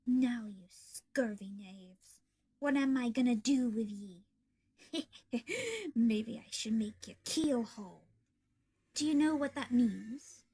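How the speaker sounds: slow menacing pirate with cackle; villain toying with his prisoners; gloating pirate. Acted. Foxy gloats over captives.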